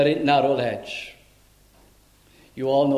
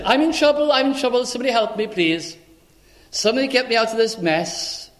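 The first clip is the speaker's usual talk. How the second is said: heart cry; 7 semitones higher than usual. Acted. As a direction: cry out for rescue like the trapped sheep. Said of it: "It has to be a heart cry".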